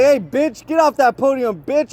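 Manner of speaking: high-pitched mock yell; hammed-up trash talk, pitched high; acted taunt. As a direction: bark the taunt like a heckler. Repeats the acted podium taunt.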